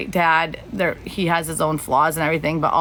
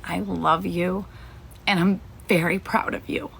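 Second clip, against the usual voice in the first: tender, emotional. Real, not acted. Tender message to Misty on stream.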